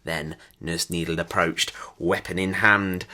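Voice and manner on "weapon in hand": ordinary delivery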